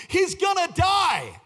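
high, loud, drawn-out exclamation; emphatic shout. Real, not acted. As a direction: shout it out slowly after a laugh. Preacher blurts out the revelation.

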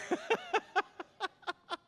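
high, drawn-out laugh; real amused laughter, pitched high. Real, not acted. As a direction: laugh helplessly at your own joke. Preacher laughs at own joke.

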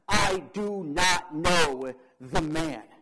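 loud, slow, emphatic oath; swearing denial. Acted. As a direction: shout the denial one word at a time. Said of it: "invoke a curse on himself and to swear".

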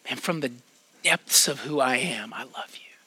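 soft, breathy whisper of love from deep inside; hushed confession. Retold or imitated. Pastor voicing a friend's love, whispered from deep inside. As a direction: whisper it like a heartfelt secret.

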